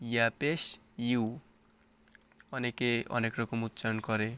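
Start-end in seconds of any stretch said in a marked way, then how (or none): 0.0-1.2 ইউ said like the English letter U. Shown as a demonstration. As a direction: say ইয়া পেশ ইউ ending on "you". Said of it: "ইউ এর মতো".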